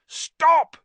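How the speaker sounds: frantic high shouted stops; yelling. Acted. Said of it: "he yelled".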